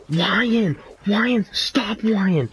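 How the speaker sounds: high-pitched imitated kid pleading; mock protest, pitched well up; Garrett. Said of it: "this other special need kid walks in".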